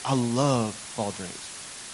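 slow, drawn-out LOVE; lingering, quietly quoted adoration; retold gushing daughter. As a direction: stretch it out as the child gushing. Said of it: "she's like".

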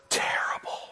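breathy whisper. Acted. Man telling the preacher what he saw, whispering terrible. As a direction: barely voice the word, in horror.

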